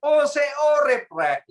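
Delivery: slow, high, loud Surabaya dialect hail; Surabaya greeting. Retold or imitated. Old friends meeting at a Surabaya reunion, loud Surabaya-accented greeting. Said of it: "Kalau orang Surabaya reuni bilang gini".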